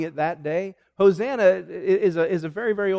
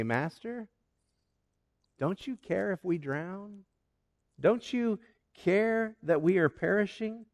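pleading; 4 semitones higher, 7 dB quieter than usual. Acted. Disciples waking Jesus in the storm.

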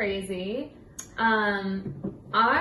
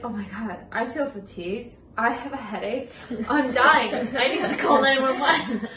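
rising hammed-up panic, louder at the end with a laugh; mock panic; 3 semitones higher, 4 dB louder than usual. Retelling her panic while studying strokes, mock panic. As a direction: play up the fake emergency, laughing at yourself.

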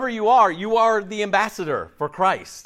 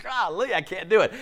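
nervous; 2 semitones higher, 3 dB quieter than usual. Acted. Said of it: "they're kinda nervous".

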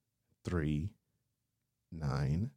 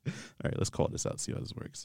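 hushed whisper before a prank call; whisper, about to prank call; giggly prank whisper; 7 dB quieter, faster than usual. Real, not acted.

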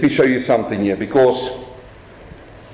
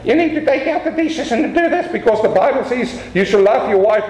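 mock preachy spouse, voice pitched up; half-mocking high bossy voice; nagging spouse; 8 semitones higher than usual. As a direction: lightly mimic a nagging husband quoting scripture. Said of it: "They will come to their husband and wife and say".

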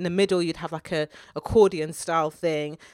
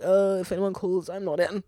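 Boris Johnson; 4 dB quieter than usual. Retold or imitated. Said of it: "Boris was like".

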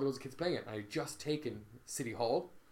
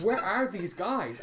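higher, louder, drawn-out mock-frantic demand; 6 semitones higher, 5 dB louder, slower than usual. Player searching for his rivals, mock frantic. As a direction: act out a frantic search, stretching the words slowly. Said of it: "looked around me frantically going".

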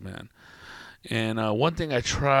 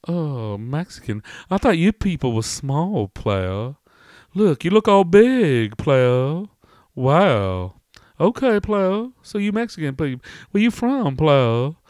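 high, loud swooping customer; nosy customer; 5 semitones higher, 4 dB louder, slower than usual. Acted. Customer sizing up a Mexican newcomer, loud high-pitched prying. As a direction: marvel and pry in a drawn-out high lilt. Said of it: "and he's all".

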